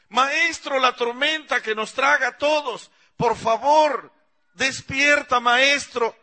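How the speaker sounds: high, loud begging shout; frantic plea. Acted. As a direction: beg him to wake above the storm. Begging master to wake. (súplica a gritos, aguda y fuerte)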